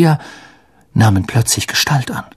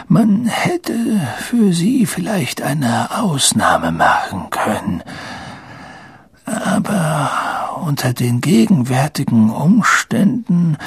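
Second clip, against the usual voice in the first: put-on slow, frail old man, regretful; sighing old headmaster; 5 semitones higher than usual. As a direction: let each phrase sag like a weary sigh. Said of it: "seufzte er". (seufzend, langsam und gebrechlich)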